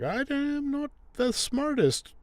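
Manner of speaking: very high-pitched goofy imitation; mock dim-witted man. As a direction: send him up as a cheerful dope. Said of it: "So he's like".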